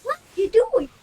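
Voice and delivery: high, loud squeaky imitation; copied annoying voice. Shown as a demonstration. Child copying the adult on request, squeaky annoying voice. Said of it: "Can you say it?".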